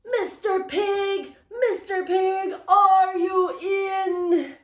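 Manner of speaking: high, slow sung call; sing-song call. Retold or imitated. Wolf calling the brick house pig in a high, slow sing-song. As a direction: croon it at the door like a song. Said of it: "I called".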